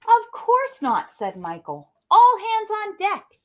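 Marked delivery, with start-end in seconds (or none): 0.0-1.1 much higher, louder and slower cry; exclaiming hero. Acted. Hero reassures Doug, exclaiming. 2.1-3.4 high, loud heroic call to action; rally the whole town; rallying cry. Acted. Hero rallies everyone.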